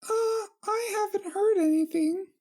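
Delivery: squeaky high princess voice, friendly; high pony princess. Acted. Twilight voiced in the reading.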